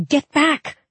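high, sharp yell of warning. A narrator's reading. Narrator to Mal as a shadow soldier attacks, warning yell. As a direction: yell to drive someone out of the way. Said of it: "I yelled".

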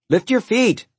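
mother's high-pitched call; calling after. A narrator's reading. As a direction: call it down the hallway after a stomping child. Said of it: "she called after".

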